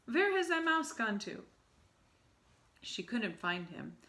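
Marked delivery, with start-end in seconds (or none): 0.0-1.4 high, loud angry witch; irritated search. Acted. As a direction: demand it, annoyed.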